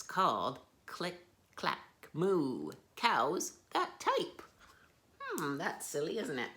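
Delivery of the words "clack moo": ordinary delivery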